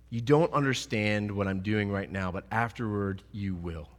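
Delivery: low, soft and reassuring; hushed tender voice of Jesus. Acted. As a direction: quietly promise they will understand. Jesus quoted softly.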